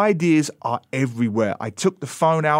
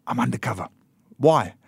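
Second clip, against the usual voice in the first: hushed, low, a hint of a secretive child; secretive daughter; 4 semitones lower, 5 dB quieter than usual. Father voicing his daughter's undercover princess secret, softly.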